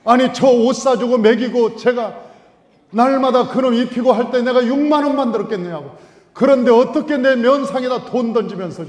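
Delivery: 억울한 아빠의 큰 목소리로 하소연; indignant rant. Acted. (억울해서 높고 크게 외치는 아빠)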